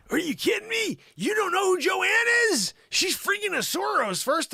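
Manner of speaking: high, loud exasperated rant; shrill hammed-up indignation; mock outrage. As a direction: rail at him in hammy disbelief.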